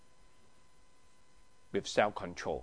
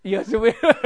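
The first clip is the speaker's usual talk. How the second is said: higher, louder angry snap of a compliment; 9 semitones higher, 10 dB louder, faster than usual. Shown as a demonstration. Teacher demoing an angry compliment to the class. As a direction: bark the flattering words like an insult. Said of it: "Say in an angry way, but the word is flattering".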